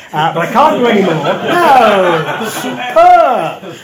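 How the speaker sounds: roaring with laughter; laughing. Real, not acted. Audience laughs at the Geordie attempt, shouting through laughter.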